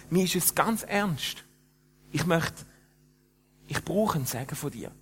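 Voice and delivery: a clubber suddenly sober and serious; earnest and sober. Retold or imitated. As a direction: ask for a blessing in earnest. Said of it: "ernst und erstaunlich nüchtern".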